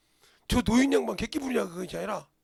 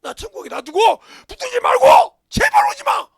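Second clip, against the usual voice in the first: building to a loud, much higher plea; desperate shout; 11 semitones higher, 16 dB louder than usual. Retold or imitated. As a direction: beg them at full cry. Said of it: "이 소리요". (간절하게 목 놓아 외치는 소리)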